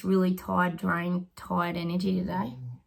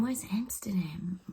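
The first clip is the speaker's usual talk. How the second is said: whisper; 7 dB quieter than usual. Real, not acted. Asking herself mid reading.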